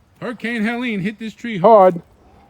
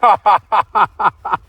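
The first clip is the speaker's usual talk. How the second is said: delighted laugh; 2 semitones higher, 6 dB louder than usual. Real, not acted. Potato dig surprise.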